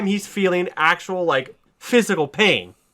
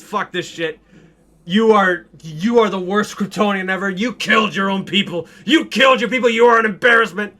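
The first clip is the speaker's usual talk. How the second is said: louder, higher, spitting contempt; furious insult; 5 semitones higher, 4 dB louder than usual. Retold or imitated. Zod hanging from the ledge scorning Superman. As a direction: hurl it at him like a curse.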